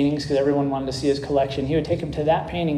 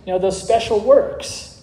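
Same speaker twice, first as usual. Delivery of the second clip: higher-pitched mock protest; retold anger; 6 semitones higher, 3 dB louder than usual. Impatient bidders protest to the auctioneer, mildly angry in the retelling. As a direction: imitate an annoyed crowd, pitch raised throughout. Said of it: "people started to get angry".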